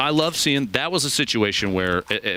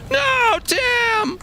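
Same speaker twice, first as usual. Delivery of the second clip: very high, loud wail of dismay; dramatic cry; 5 dB louder than usual. Pretend. Caster watching Tim go down, hammed-up anguished cry. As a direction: cry out for a fallen hero. Mock despair as player dies.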